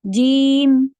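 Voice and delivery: جیم said loud and crisp with no added breath; clear jeem. Shown as a demonstration. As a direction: hit جیم clean and strong, no air. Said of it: "جیم بالکل clear ہوتا ہے ہوا کے بغیر". (جیم بالکل صاف، ہوا کے بغیر)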